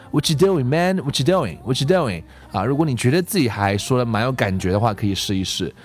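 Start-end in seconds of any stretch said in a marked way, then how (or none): 0.1-2.2 "What you doing" loosely slurred as whatcha doin; casual whatcha, tossed off; the right intonation. Shown as a demonstration. Teacher shows learners the intonation to find, casual whatcha. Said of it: "所以你要去找那个腔调".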